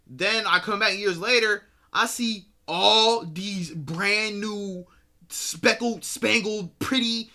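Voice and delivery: high and loud, piling up words; building amazed outburst; excited rant. Real, not acted. Ranting about new characters.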